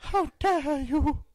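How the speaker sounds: put-on proud of a hammy English accent. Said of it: "I nailed that".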